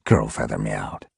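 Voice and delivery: usual speaking voice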